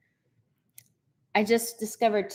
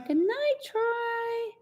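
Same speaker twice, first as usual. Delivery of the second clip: high, slow, drawn-out goodnight; sing-song farewell; 13 semitones higher, 4 dB quieter, much slower than usual. Real, not acted. Host joins viewers saying good night to Troy, high sing-song. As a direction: sing it out sweetly, stretching each word.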